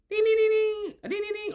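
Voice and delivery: high-pitched "ding" beeps; imitated dinging signal. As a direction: chime like a locator alert, high and repetitive. Imitates the star signalling its spot.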